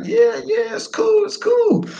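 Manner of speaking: very high, polite agreement; squeaky mock agreement; squeaky yes. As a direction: force out a cheery yes. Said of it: "And I'm like".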